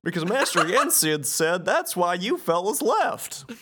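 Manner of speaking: Goofy. Acted. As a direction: bumble through it as the cartoon goofball. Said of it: "Goofy says".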